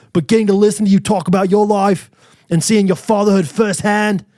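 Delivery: mock Australian-London reading voice; put-on Australian accent. Podcaster reading fan's compliments in put-on Australian accent. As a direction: read it in a wobbly Australian accent. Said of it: "I'm talking his accent while he says these nice things".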